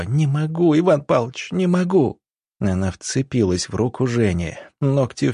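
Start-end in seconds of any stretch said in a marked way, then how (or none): 0.0-2.2 high, anguished plea; desperate cry. A narrator's reading. Woman in labour cries to the doctor, desperate. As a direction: cry out in despair, pitched well up. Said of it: "Марина закричала снова".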